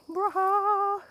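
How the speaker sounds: high loud goofy blurt; silly exclamation. Real, not acted. Goofing around for the chat, a high silly blurt. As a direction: burst out with a silly noise.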